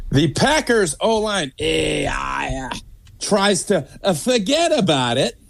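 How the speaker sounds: put-on Sopranos-style New York accent, drawn out; hammed-up New York accent with slow drawn-out phrases. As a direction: play a wiseguy from New York. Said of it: "Was that a New York accent?".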